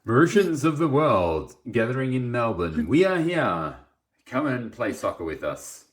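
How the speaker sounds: loud, high mock announcement; announcer voice. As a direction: call it out like a club announcer.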